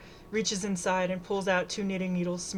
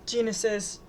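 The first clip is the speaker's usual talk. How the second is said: plain reading voice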